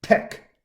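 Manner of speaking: "tech" ending on a hard K; author's pronunciation. Shown as a demonstration. Teacher gives the author's way of saying TeX, "tech" as tek. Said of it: "the author like to pronounce".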